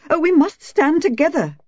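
very high, mocking society-woman voice; savage mimicry. A narrator's reading. Wife mimicking a pious neighbour, savage high mockery. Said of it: "she mimicked savagely".

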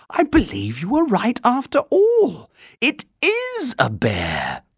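high, wondering exclamation with a catch; almost tearful astonishment, high; exclaiming. A narrator's reading. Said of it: "she exclaimed".

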